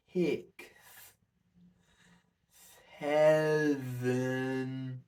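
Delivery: slow count with the tongue stuck out. Shown as a demonstration. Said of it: "try this, nice and slow".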